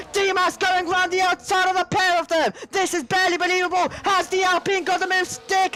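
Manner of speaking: excited race call, building; rising live play-by-play; motorsport commentator. Acted.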